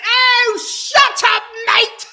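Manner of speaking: loud, high scornful yell; mocking shout. James cutting off Tom's speech, loud mock shout. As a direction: cut him off with a loud sneer. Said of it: "He was like".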